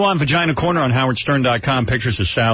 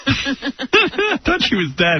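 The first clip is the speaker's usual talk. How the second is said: laughing; 12 semitones higher, much slower than usual. Real, not acted. Joke about [name] being dead.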